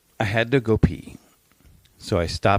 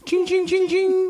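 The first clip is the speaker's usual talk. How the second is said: very high chiming; mimicking the charity bell, high; imitated bell. Shown as a demonstration. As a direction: ring it like a tiny bell. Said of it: "imitating the bell".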